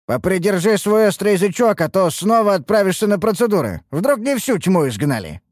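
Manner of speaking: high, emphatic comeback; warning retort. A narrator's reading. Master to sharp-tongued servant, threatening retort. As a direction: warn them to watch their mouth.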